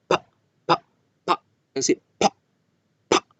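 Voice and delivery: Pa with a hard burst of air; explosive p. Shown as a demonstration. Teacher demonstrates the plosive p to the class. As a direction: blow the lips open on each pa. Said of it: "yo debo hacer la plosión".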